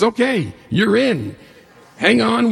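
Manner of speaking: like a pumped DJ on air; excited radio host. Retold or imitated. Radio host welcoming him into the race, excited.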